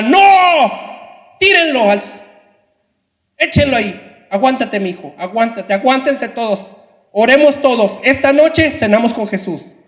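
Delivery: high, loud fervent cries; rally the family to stand firm; wife shouting to her family at the pit; defiant cry. Acted. Wife defiantly urges martyrdom.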